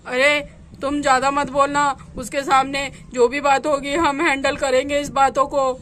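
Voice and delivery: a nervous husband dreading home; worried man. Acted. Husband tells brother-in-law he fears going home, low worried male voice.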